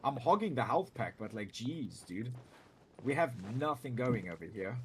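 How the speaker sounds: ordinary delivery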